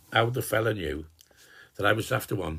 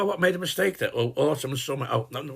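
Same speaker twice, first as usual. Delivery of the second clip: normal talking voice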